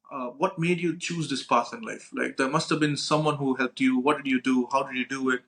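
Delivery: ordinary delivery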